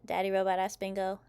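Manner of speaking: usual speaking voice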